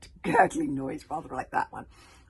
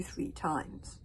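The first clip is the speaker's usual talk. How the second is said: usual speaking voice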